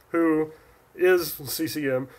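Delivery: usual speaking voice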